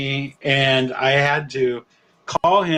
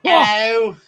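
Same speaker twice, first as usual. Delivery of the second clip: bad Australian accent; 3 semitones higher, slower than usual. Co-host showing off a bad Australian accent. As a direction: do a clumsy Aussie greeting. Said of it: "My bad Australian accent".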